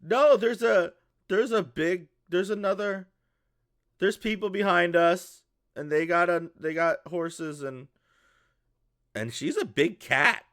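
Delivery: put-on high, loud child voice; excited little kid. Little boy tells his dad about the big cat, excited kid voice. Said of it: "And then he goes".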